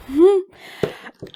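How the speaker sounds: high excited giggle; giddy laugh. Real, not acted. Opening a parcel, high giddy laugh. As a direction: let a delighted laugh burst out.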